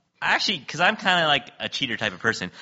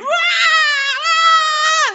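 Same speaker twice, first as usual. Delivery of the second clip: high, loud mock wail; hammed-up screaming wah; mock wailing screams; 9 dB louder than usual. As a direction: howl like a distant crowd. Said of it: "there's all this screaming".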